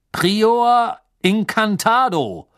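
loud, high, drawn-out spell casting; booming wizard's command; thundering incantation. A narrator's reading. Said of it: "rief er mit donnernder Stimme".

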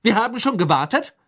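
high-pitched officious reproach; bossy, disapproving. A narrator's reading. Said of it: "herrische, missbilligende Stimme".

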